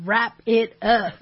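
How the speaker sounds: loud, slow mock order; mock command. Woman answering "not yet," loud mock command. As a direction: call it out slowly for laughs. Voicing impatient woman's reply.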